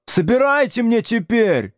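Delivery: high-pitched indignant yell; Градусов. A narrator's reading. Pupil demands teacher pick up his things, wailing. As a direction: wail the demand in protest. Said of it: "завопил Градусов".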